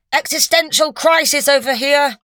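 high, loud curt snap; snapped. A narrator's reading. Doctor brushing off Matt, curt snap. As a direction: snap it off impatiently. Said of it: "snapped the doctor".

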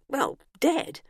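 plain reading voice

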